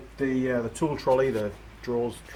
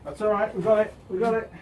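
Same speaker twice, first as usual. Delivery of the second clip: excited; 8 semitones higher than usual. Real, not acted. Engine lowered successfully.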